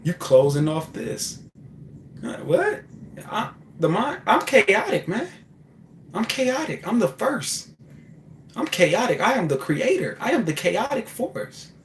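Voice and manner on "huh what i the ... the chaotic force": voice of chaos. Pretend. The chaotic mind answering the scared driver, grandiose and high. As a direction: boast as the chaotic first force, pitched way up.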